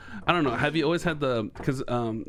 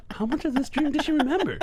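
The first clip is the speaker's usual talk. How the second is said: mock disbelief; 14 semitones higher than usual. Acts out own amazed reaction to video.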